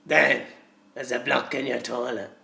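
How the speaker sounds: a lightly done sinister ringmaster; Papa Lazarus line. Retold or imitated. Plumber quoting Papa Lazarus's toilet line, lightly menacing. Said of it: "He goes".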